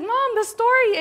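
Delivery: high, exasperated retold protest to mom; dumbfounded kid. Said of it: "I was dumbfounded".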